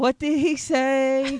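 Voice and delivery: high, slow, loud mocking copy; hammed-up copy of her sassy line; imitating sassy voice. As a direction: parrot her sassy squeal back. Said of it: "that's a strange thing that you just did".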